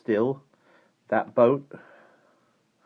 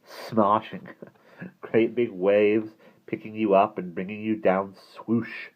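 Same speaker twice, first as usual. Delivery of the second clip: a touch of teasing glee; lightly voiced wicked grin; wicked relish; 4 semitones higher, 4 dB louder than usual. A narrator's reading. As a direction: relish it mischievously, a little higher and louder. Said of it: "Simon grinned wickedly".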